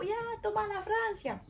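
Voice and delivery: very high, squealed cheer; retold celebration. Waving the flag among friends, gleeful cheer.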